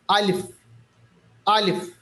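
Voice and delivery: अलिफ with a long open Arabic a, the sound flowing from the mouth cavity; Arabic alif demo. Shown as a demonstration. Teacher demonstrates alif for tajweed students, clear Arabic pronunciation. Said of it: "कैसे पढ़ेंगे?".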